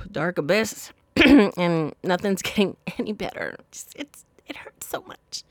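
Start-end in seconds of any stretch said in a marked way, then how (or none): 3.7-5.4 hushed, aching whisper; barely voiced, hurting; pained whisper. Real, not acted. To listeners about cheating pain, a pained whisper.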